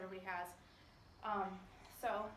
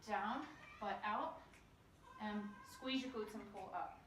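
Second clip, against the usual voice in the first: slow, spaced cues; 3 dB quieter than usual. Shown as a demonstration. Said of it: "Romanian deadlift is".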